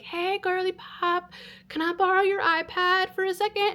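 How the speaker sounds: put-on high, soft girly wheedle; put-on sweet little request; sweet-talking. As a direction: ask casually in a high, soft, sugary voice. Sweet-talking a girl for her iPad.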